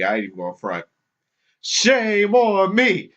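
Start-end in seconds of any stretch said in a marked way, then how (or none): 1.6-3.1 high, slow, gloating mock chant; wrestling heel taunt. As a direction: ham it up like a wrestling villain. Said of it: "acting like the bad guy in W W E".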